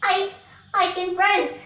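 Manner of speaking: high shrieking cries for help; screaming. Acted. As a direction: scream in panic as the witch. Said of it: "The witch screamed".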